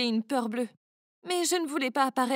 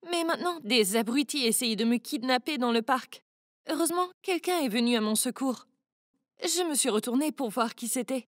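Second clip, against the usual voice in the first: ordinary delivery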